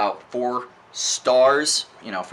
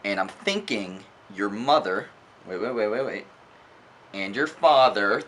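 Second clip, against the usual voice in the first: normal talking voice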